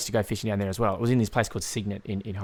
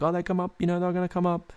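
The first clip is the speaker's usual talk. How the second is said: lightly voiced old Hungarian man, higher pitch; a hint of excited grandpa, pitched up; old Hungarian pop; 7 semitones higher than usual. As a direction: mildly imitate grandpa's confident prediction. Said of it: "he used to say it every now and then".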